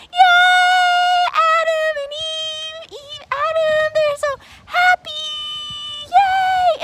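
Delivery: high, loud joyful yay; gleeful celebration of the happy couple; cheering. Acted. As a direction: cheer like a delighted child.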